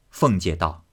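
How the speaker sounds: ordinary delivery, nothing marked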